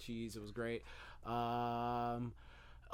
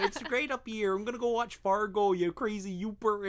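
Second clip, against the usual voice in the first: high, loud mock Minnesotan; hammed-up Minnesota accent; 9 semitones higher, 9 dB louder than usual. Mimicking Minnesotans at the fair for the co-host, hammed-up accent. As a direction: pile on the Minnesota accent, big and comic. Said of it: "listen for those, like, accents".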